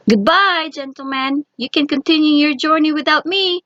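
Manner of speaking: jeering. A narrator's reading. Tom escaping into a mouse hole, jeering at the two men. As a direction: tease them as you vanish. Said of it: "he jeered".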